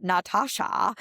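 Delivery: imitating mom's German accent, open a's. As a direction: say Natasha like a German mother. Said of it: "in her German accent".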